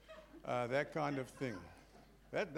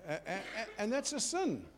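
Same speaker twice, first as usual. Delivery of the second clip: laughing; 5 semitones higher than usual. Real, not acted. Joking about shooting drivers.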